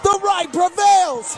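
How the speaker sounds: slow triumphant repeat. Real, not acted. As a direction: savor every word of it. Slower repeat.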